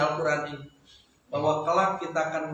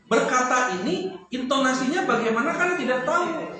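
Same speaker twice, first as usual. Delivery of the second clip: ordinary talk, nothing marked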